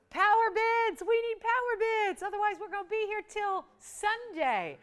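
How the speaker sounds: rally the bidders, pushing hard; hyped call. Real, not acted. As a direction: auctioneer pumping up the crowd. Host hypes the bidding.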